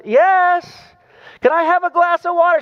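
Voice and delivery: put-on high small boy's voice calling out from bed; little boy calling. Little boy calling his mother from bed, high childlike voice. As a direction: pitch way up like a kid calling his mom.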